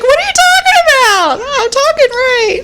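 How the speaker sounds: high-pitched mock protest; indignant accent sufferer. Accent sufferer answering doubters, high mock indignation. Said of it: "started talking with a British accent".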